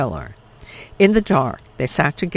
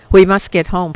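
louder, higher, pressing order; urgent command; 4 semitones higher, 9 dB louder than usual. Acted. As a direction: hurry his daughter home from danger. Father urgent in panic.

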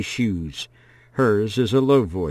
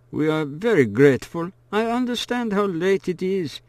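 lightly raised, polite father voice; grateful father; 9 semitones higher than usual. A narrator's reading. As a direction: thank her humbly as the father. Father thanking the hostess.

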